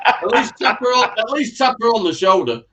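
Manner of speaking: mock scolding shout. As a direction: scold him jokingly, loud. Joking scolding, raised voice.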